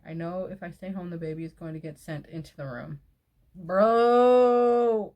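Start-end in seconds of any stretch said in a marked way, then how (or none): 3.5-5.1 loud, drawn-out exasperated cry; exasperated outburst. Real, not acted. Reacting to a partner left with the baby, mad outburst. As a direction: let the exasperation burst out. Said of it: "steam coming out of my ears".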